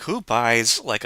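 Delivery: ordinary talk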